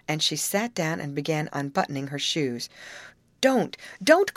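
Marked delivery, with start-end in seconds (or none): 3.4-4.3 high, loud repeated protest; urgent cry. A narrator's reading. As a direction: cry out to stop them. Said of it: "cried Molly".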